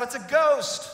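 sudden high, loud cry of fright; frightened cry. Retold or imitated. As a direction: shout it like a terrified sailor. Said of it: "One of them cries out".

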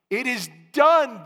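high, loud climactic shout; biggest exclamation point. Shown as a demonstration. Preacher crying out the biggest exclamation point to the church, full shout. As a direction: let it break like an earthquake. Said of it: "The biggest exclamation point".

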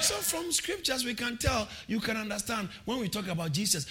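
normal talking voice

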